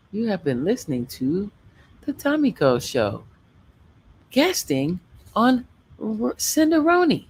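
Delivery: a streamer doing a mock radio announcer outro. Shown as a demonstration. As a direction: read it like a radio host closing the show. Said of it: "Let me put in my radio voice".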